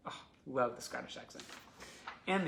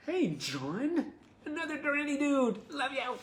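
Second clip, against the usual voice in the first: high, sing-out greeting; excited shout-out; 14 semitones higher, 6 dB louder than usual. Real, not acted. Host hails fan John who wrote in, excited shout-out. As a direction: call out a fond hello, pitched way up. Calls out greeting to letter writer.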